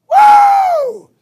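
very high, loud drawn-out whoop; over-the-top cheer; gleeful whoop. Acted. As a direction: let out a huge piercing "woo". Cheers at being shunned for faith.

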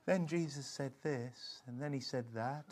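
very quiet, low, slow reading; soft-spoken Bible reader. Shown as a demonstration. Preacher mocking timid church Bible readers, very soft and low. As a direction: read it meekly, barely above a murmur. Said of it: "the Bible reader can read it and just be like".

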